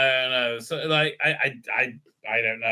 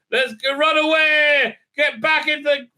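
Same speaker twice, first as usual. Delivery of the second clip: mock panic; 9 semitones higher, 4 dB louder than usual. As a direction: shriek like a fleeing coward. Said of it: "they're like".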